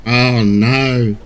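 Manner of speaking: mild, put-on grumble; grumbling. A narrator's reading. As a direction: grumble it in character, wearily. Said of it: "he grumbled".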